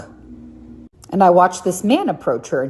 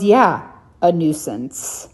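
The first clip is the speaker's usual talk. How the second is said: "nuisance" said slowly and flatly, falling; slow, deliberate falling "a nuisance"; slow firm repeat; slower than usual. Retold or imitated. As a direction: firmly restate "Yeah, a nuisance." slowly. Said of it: "She goes".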